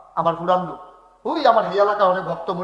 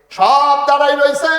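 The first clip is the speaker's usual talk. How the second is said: full-force preaching; 10 semitones higher, 10 dB louder than usual. Real, not acted. Preacher telling of the dead standing around, loud chanting cry. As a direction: call it out to the whole gathering. Preacher cries out the unseen crowd.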